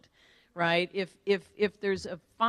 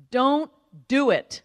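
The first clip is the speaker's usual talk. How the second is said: loud, slow, higher-pitched mom's veto; emphatic warning; 5 semitones higher, 8 dB louder, slower than usual. Retold or imitated. Mother warning daughter off financial services, emphatic. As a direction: drive each word home, slowly.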